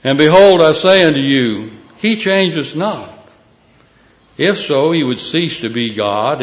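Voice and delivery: fiery preaching. Acted. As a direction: proclaim it from the pulpit. Preaching scripture at full force.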